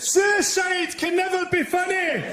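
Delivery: high, slow, shouted rebuke; parting shout. Retold or imitated. Angry heckler storming out of the gig, shouting his rebuke. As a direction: yell it back over your shoulder as you storm out. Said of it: "he turned around and went".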